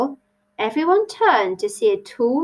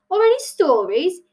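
higher-pitched hopeful question; 9 semitones higher, 3 dB louder than usual. A narrator's reading. Hare asks Tilly for stories, hopeful. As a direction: lift it hopefully. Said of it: "he added hopefully".

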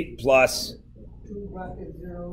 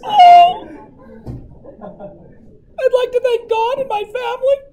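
squeaky-high pretend weeping; hammed-up tearful acceptance speech, very high; mock tearful. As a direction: fake being overcome with proud tears. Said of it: "you're gonna make me cry".

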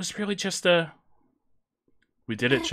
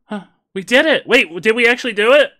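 loud, high, quick; real shock at winning; surprised disbelief; 7 semitones higher, 7 dB louder than usual. Real, not acted. Surprise at beating the boss.

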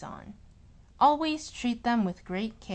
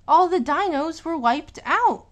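excited climax; 6 semitones higher, 9 dB louder than usual. A narrator's reading. Climax of the underpants war.

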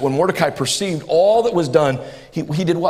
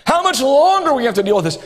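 crying out to God; 5 semitones higher than usual. Retold or imitated. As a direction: cry out as God's weary people, stretching "longer". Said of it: "God's people crying out and saying".

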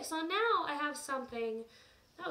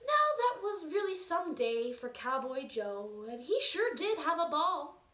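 put-on reading to children; 5 semitones higher, 3 dB louder than usual. Reads story to children.

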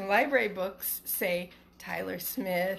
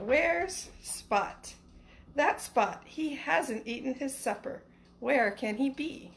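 bright, lilting storybook read, a little higher; reading to children; 4 semitones higher, slower than usual. Acted. Teacher reading Where's Spot to her class, storybook lilt. Teacher reads picture book aloud.

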